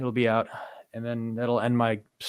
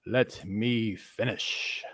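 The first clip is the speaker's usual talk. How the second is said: slow insistence; 2 semitones lower, 6 dB quieter, much slower than usual. Real, not acted. Insists on finishing his point.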